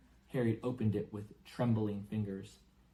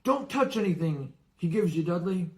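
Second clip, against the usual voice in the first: higher, louder sharp warning; father warns Dudley off the food, sharp; 8 semitones higher, 7 dB louder than usual. Acted. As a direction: snap a warning at your son. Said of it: "said sharply".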